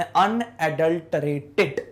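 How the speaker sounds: अनएडल्टरेटेड sounded out slowly, syllable by syllable; slow syllable-by-syllable reading. Shown as a demonstration. Teacher to students, sounding out अनएडल्टरेटेड slowly. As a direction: drag out अनएडल्टरेटेड piece by piece. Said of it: "एक बार याद करो कैसे पढ़ा था हमने".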